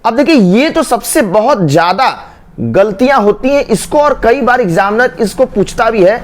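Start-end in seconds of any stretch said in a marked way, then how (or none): none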